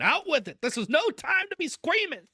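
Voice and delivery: high, loud, exasperated order; impatient demand. A narrator's reading. Uncle demanding the squeamish cousin speak, exasperated. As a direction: press her to spit it out.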